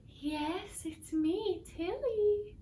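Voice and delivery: rising high spooky call; [name] faking a ghost at the seance; mock ghost voice. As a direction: float it up like a fake spirit.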